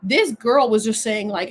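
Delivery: ordinary delivery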